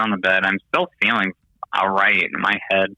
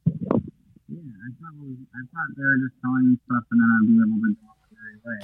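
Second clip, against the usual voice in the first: underwater; 4 semitones higher, 4 dB quieter than usual. Real, not acted. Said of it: "It sounds like you're underwater".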